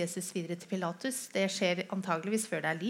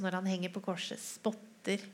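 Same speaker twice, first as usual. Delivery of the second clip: ordinary talk, nothing marked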